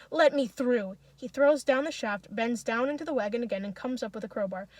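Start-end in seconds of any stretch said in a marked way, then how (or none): 0.1-1.0 raised, forceful demand; loud angry order; furious yell. A narrator's reading. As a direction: push through with your voice. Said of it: "Misha yells furiously".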